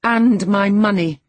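high, loud, drawn-out defiance; defiant. A narrator's reading. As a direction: throw it back at him loud and slow. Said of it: "she continued, returning his angry glare".

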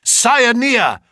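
high, loud cry of discovery; triumphant shout. A narrator's reading. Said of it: "burst into a shout of triumph".

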